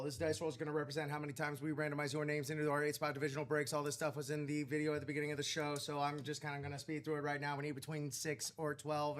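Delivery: fast disclaimer read. Pretend. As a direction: rattle it off like fine print. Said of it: "A little disclaimer voice".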